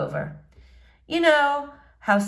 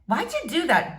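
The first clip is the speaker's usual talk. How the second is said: higher, sharp challenge; mild snap; 8 semitones higher than usual. A narrator's reading. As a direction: call him out as the girl. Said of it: "April snaps".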